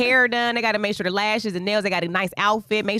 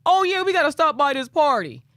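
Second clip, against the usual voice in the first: mild mock yell, pitched up; imitated yell back; 6 semitones higher, 3 dB louder than usual. Husband yells back about the party, imitated casual yell. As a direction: call back casually as if from another room. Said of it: "Josh yells back".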